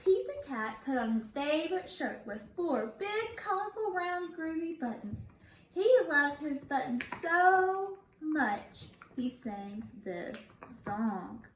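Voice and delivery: bright storybook read-aloud; lively storytime voice; reading to children. Acted. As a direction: read it like a children's picture book. Teacher reads picture book aloud.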